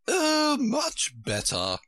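high exclamation easing off; crying out. A narrator's reading. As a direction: cry out high, then draw out the rest. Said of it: "cried the Queen".

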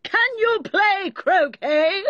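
slow, high, drawn-out shouted question; shouting queen. A narrator's reading. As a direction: demand it imperiously at full stretch.

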